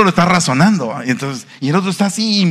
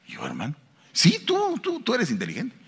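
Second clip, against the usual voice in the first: shy doubtful brother; 3 semitones higher, 7 dB quieter than usual. Retold or imitated.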